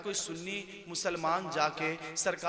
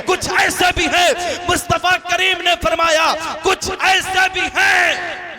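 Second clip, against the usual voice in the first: shouted, high preacher's voice; full-force sermon shout; fiery preaching; 8 semitones higher, 15 dB louder than usual. Real, not acted. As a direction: thunder it at the congregation.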